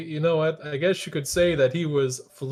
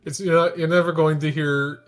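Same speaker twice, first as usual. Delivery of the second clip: ordinary delivery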